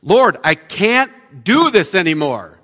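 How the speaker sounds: loud, raw cry to God at three in the morning; desperate yell. Retold or imitated. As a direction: shout it out, at the end of your rope. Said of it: "I just yelled".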